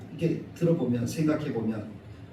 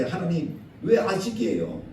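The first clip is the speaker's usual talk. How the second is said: rising, louder complaint to God; complaining to God; 8 semitones higher, 5 dB louder than usual. Retold or imitated. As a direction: whine at God, building up. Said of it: "우리가 살아가다 보면 그럴 때".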